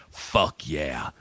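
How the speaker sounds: low, soft satisfied fuck yeah; viewers cheering. Retold or imitated. Regular viewers cheer Tony on, low and soft. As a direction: mutter approval under the breath, pleased. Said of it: "regular people watching that are like".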